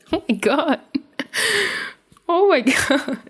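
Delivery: breaking up with real laughter; can barely speak for laughing. Real, not acted. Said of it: "Can't read this with a straight face".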